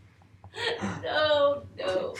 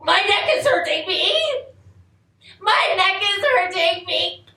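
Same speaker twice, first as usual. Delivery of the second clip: higher, louder cry of pain; sudden loud complaint; 6 semitones higher, 4 dB louder than usual. Acted. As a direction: blurt it out, pained.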